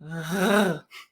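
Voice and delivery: a guttural uvular "rrr"; uvular trill. Shown as a demonstration. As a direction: trill the back of the tongue on the uvula. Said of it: "it sounds like this".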